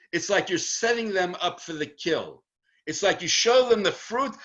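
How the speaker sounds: ordinary talk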